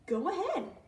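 high, eager pollywog voice; excited tadpole cheering on his friend; excitedly. A narrator's reading. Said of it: "the pollywog said excitedly".

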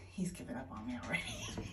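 soft fast whisper aside. Real, not acted. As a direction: whisper it so he won't hear.

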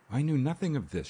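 quickly. A narrator's reading. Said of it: "she said quickly".

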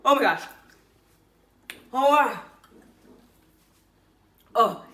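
burning mouth, gasping exclamations; reacting to spicy chili. Real, not acted. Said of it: "that's so spicy".

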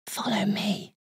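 a tiny hidden voice calling softly; whisper. A narrator's reading. A tiny voice guiding the exhausted Mouse, whispered. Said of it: "it whispered".

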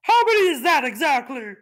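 very high, loud snorty boast; snorting warthog. Acted. The warthog outbids the macaw, very high and snorty. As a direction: snort it out defiantly.

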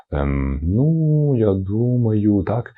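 very drawn-out, hesitant thinking aloud; stalling for time. Shown as a demonstration. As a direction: stretch every word while you think. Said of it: "потягнути час і щось придумати". (тягнучи час)

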